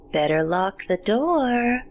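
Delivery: slow creature voice, drawn out and swooping up; eerie parting warning. A narrator's reading. Creature to the fleeing child, slow eerie warning. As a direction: stretch it out like a haunting taunt. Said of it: "so softly I wasn't certain I'd heard".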